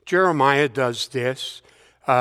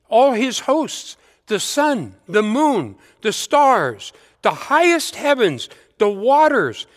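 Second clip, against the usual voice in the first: rising, ringing psalm reading, each item lifted higher; scripture reading; 5 semitones higher than usual. Acted. Preacher reading Psalm 148 to the congregation, raised and ringing. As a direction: lift every item of the psalm as a proclamation.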